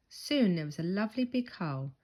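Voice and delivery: plain reading voice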